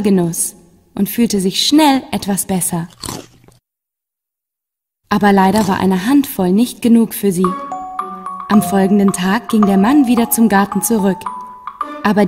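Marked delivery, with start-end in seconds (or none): none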